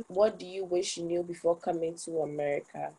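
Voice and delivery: usual speaking voice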